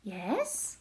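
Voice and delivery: usual speaking voice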